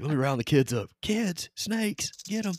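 high, loud mock hillbilly call; [name] calling kids.

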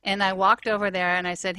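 ordinary talk, nothing marked

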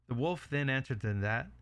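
ordinary delivery

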